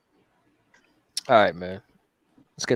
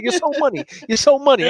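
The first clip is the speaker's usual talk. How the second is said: high, mocking so money; mock Vince Vaughn; 11 semitones higher than usual. Owner [name] quoting Swingers at crying patient, high mocking voice. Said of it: "He's like".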